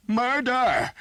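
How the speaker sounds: very high, very loud scream; screaming alarm. Acted. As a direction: scream it out in desperation. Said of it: "he screamed".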